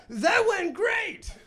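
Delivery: high, loud, slow mock cheer; over-the-top confident yell; acted confidence. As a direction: shout it triumphantly, drawn out. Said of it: "I've never said confident things".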